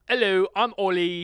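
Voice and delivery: hammed-up British footballer introducing himself; mock British accent. Imitating Ollie introducing himself, mock British accent. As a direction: put on a cheery British accent. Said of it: "He starts it off with saying".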